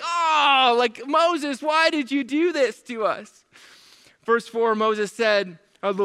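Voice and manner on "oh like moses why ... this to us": mock whining complaint. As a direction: imitate people complaining to their leader. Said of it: "You hear the complaint".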